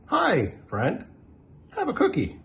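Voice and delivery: a beaming hero offering a cookie; friendly superhero. Acted.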